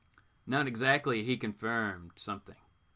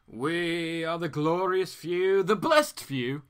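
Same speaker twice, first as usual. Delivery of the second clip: slow, grand proclamation, a bit louder; Ivy grandly; 3 semitones higher, 5 dB louder, much slower than usual. Acted. Ivy tells Green Arrow her kind is chosen, slow and grand. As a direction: savor each word like a chosen one. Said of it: "says Ivy".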